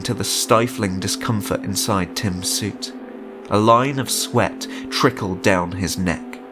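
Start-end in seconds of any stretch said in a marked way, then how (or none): none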